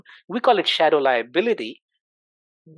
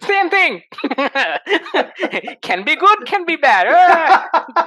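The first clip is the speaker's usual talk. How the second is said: laughing, higher and louder; 11 semitones higher, 7 dB louder, much slower than usual. Real, not acted. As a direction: laugh through the words. Laughing at the mayonnaise joke.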